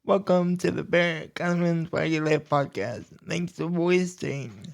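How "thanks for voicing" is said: ordinary talk, nothing marked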